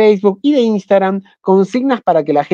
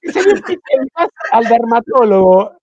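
higher, lightly voiced fussy doctor; mock dermatologist; 6 semitones higher than usual. Teasing Pablo as a doctor about his pixels, lightly higher.

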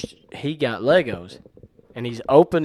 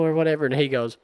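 ordinary delivery

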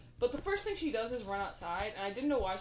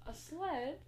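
sadly; 6 semitones higher than usual. Retold or imitated. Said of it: "Drew delivers his line so sadly".

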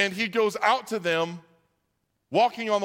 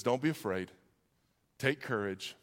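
low, soft, calming delivery; gentle reassurance; 7 semitones lower, 7 dB quieter than usual. Acted. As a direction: comfort someone quietly, phrase by phrase. Repeats Jesus's words as comfort.

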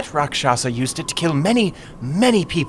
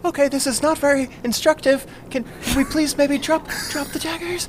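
nervous plea; 11 semitones higher than usual. Acted. Captive pleads to drop daggers.

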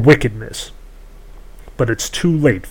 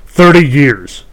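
louder barked demand, short and final; 4 semitones higher, 8 dB louder, slower than usual. A narrator's reading. The woman setting her price to the princess, a louder bark. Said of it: "the woman barked".

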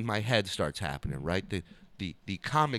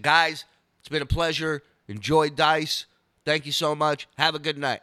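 louder, raised host goodbye; stage sign-off; 4 semitones higher, 5 dB louder than usual. Retold or imitated. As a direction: imitate thanking a theater crowd and leaving. Retold sign-off to theater audience.

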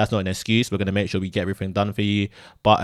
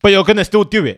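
loud, insistent, pitched up; raised voice; 8 semitones higher, 6 dB louder than usual. Real, not acted. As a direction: drive the point home loudly. Heated argument on podcast.

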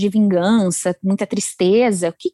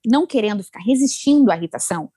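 ordinary talk

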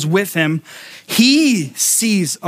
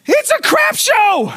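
very high, drawn-out comic wail; hammed-up frantic outburst; exasperated mock yell; slower than usual. Retold frantic answer to wife.

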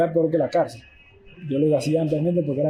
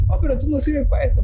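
imitando al entrenador, más agudo y con desdén; entrenador despectivo; 9 semitones higher, 3 dB louder than usual. Retold or imitated. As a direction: remeda al entrenador que lo descarta, voz alzada. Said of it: "me dice". (el entrenador le dice que no sirve, imitado con desprecio)

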